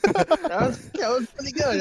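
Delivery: laughing. Real, not acted. Laughing praise after the bit.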